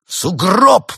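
loud, high angry snarl; growled threat. A narrator's reading. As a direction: bark it as a threat. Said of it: "прорычал антиквар". (антиквар громко рычит угрозу)

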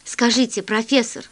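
plain reading voice

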